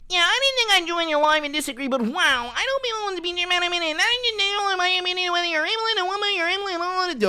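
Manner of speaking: high, fast garbled mock voice; mocking gibberish. Streamer mocking a guest in garbled falsetto. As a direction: babble like a silly caller. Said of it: "we got fucking Molly".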